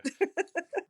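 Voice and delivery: high laugh. Real, not acted. Laughing after "so exciting.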